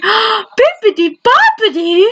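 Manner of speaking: high, slow, sing-song fairy godmother voice; drawn-out magic-spell chant, high and slow; Fairy Godmother quote. Retold or imitated. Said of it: "I've seen these movies one too many times".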